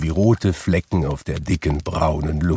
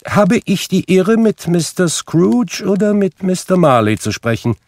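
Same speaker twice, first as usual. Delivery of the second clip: much higher, formal courteous inquiry; polite portly gentleman; 12 semitones higher, 4 dB louder than usual. A narrator's reading. Charity gentleman addressing Scrooge politely. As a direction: ask with stiff politeness.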